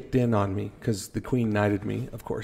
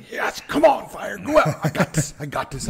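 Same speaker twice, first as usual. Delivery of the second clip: high, pumped-up cheering at the fire, half laughing; retelling a pumped-up rush in the basement, high and laughing; adrenaline-fuelled hype; 9 semitones higher than usual. Retold or imitated. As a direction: egg yourself on, buzzing with adrenaline. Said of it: "my adrenaline's going too".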